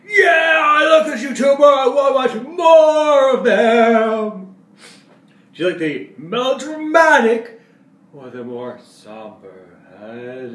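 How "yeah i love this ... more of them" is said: higher, louder fan shouting with delight; excited fan. Pretend. Vlogger imitating an excited fan of a YouTuber, shouting. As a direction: yell it like a thrilled viewer. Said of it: "like, gets you going".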